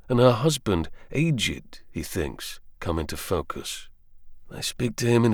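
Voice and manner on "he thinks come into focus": ordinary delivery, nothing marked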